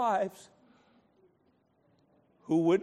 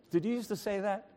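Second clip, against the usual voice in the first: usual speaking voice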